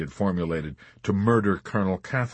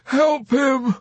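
high, loud, anguished wail; weeping plea; 7 dB louder, much slower than usual. A narrator's reading. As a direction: wail it, begging for help. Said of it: "Dobbs wept".

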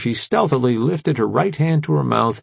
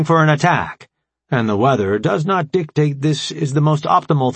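formal AI commander, raised and emphatic; brief the allies in a higher, deliberate voice; Alpha Prime; 5 semitones higher, 3 dB louder than usual. Acted. Voices the AI leader reasoning.

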